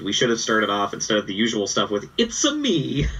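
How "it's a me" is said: high squeaky mock Mario; Mario impression. Co-host jokes to open the show, mock Mario. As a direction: chirp it like Mario, hammed-up. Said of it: "terrible impression".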